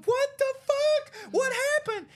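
very high, drawn-out mock cry; retold bewildered wail. Bleeding brother left sitting there, bewildered high wail. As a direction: wail it slowly in hammed-up disbelief.

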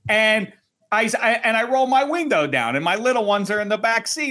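ordinary delivery

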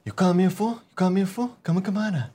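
high mumbled challenge. Acted. Answering in an impression battle, high mumbled taunt. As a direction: slur the taunt, pitched high.

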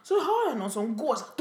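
ordinary talk, nothing marked

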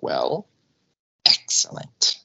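low, gravelly-free breathy villain purr; Mister Burns impression. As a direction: savor it like a scheming old tycoon. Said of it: "In his best Mister Burns voice".

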